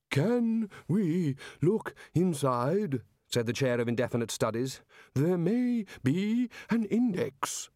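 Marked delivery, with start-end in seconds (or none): 0.1-3.0 very slow, high-pitched tentative question; high, drawn-out wary voice; hesitant timid wizard. Acted. Timid wizard asks about the book. 5.1-7.7 very slow, high-pitched tentative question; high, drawn-out wary voice; hesitant timid wizard. Acted. Same wizard continues tentatively.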